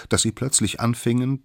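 plain reading voice

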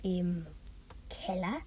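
secretive hush. Acted. Boy confiding the loot's hiding place, hushed. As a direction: let the secret out under your breath.

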